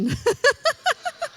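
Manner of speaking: high giggling laugh; high burst of laughter. Real, not acted. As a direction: laughs out loud at herself. Laughs at own joke.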